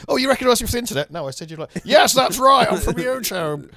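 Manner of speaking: high, fast squeaky mock voice; mock surprised reply. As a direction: blurt it out, high and delighted. Acting out a beach drug dealer exchange.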